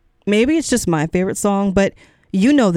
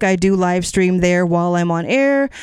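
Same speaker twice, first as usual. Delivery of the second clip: normal talking voice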